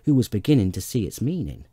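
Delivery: ordinary delivery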